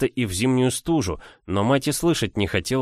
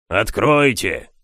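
slow, loud growl; sepulchral growl; 5 dB louder, slower than usual. A narrator's reading. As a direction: growl it from the grave. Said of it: "рычал могильный голос".

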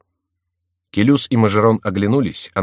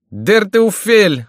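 high, loud, drawn-out oath; furious high-pitched exclamation; cursing cry; 13 semitones higher, 7 dB louder, much slower than usual. A narrator's reading. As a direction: stretch the curse out at full voice. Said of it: "вскричал он".

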